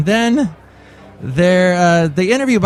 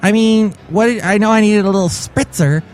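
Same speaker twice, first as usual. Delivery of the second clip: higher-pitched affronted character; indignant commercial mom; 6 semitones higher than usual. Acted. Commercial mom doused with water by floor cleaner, indignant. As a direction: protest at being suddenly drenched. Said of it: "one of the actresses".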